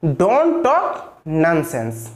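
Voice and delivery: very slow, every word drawn out for pupils to repeat; slow repeat-after-me. Shown as a demonstration. Teacher asking students to repeat after her, very slow. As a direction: say it slowly so the class can follow. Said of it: "आप लोग इसको बोलिए मेरे साथ".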